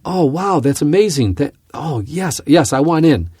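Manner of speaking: high, loud, gushing phone talk; mock thrilled investor, a touch overdone; imitating loud excited caller. Pilot mimics instructor's loud phone call, excited. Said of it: "He's talking really loudly".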